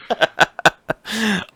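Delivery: high-pitched burst of laughter; amused laugh. Real, not acted. Friend concedes he might be a fairy, amused laugh. Co-host admits he'd be a fairy.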